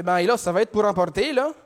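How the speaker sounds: imitated Québécois customer; Québécois accent. Customer orders takeaway at Tim Hortons, Québécois accent. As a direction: put on a broad Québécois accent. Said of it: "je vais faire un accent".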